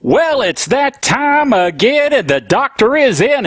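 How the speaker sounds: a touch of radio host; show opener. Acted. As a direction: open the show like an announcer. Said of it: "It's time once again for [name]".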